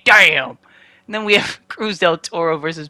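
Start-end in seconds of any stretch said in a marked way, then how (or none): 0.0-0.6 a sudden loud retold exclamation; loud damn. Farouk barging in on the boss, loud exclamation. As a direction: blurt it out like a wrestler reacting. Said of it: "Farouk comes in to say".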